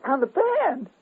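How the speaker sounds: very high, disbelieving echo; incredulous echo. Retold or imitated. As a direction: repeat it in disbelief. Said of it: "I said".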